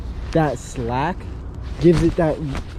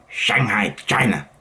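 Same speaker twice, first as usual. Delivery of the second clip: lower, falling attempt at a Chinese accent; 5 semitones lower, slower than usual. Vlogger retrying Shanghai after a bad take, Chinese accent. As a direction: imitate a Chinese accent, pitched down. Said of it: "that was better".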